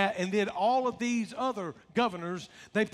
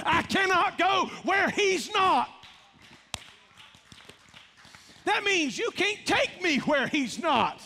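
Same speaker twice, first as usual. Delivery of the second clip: preaching shout; 7 semitones higher, slower than usual. Real, not acted. Preacher climaxes the point.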